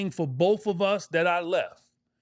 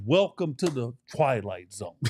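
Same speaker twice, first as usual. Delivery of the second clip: deadpan teammate; 9 semitones lower than usual. Retold or imitated. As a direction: drop the voice low and deadpan it. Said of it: "the dude looks at me and says".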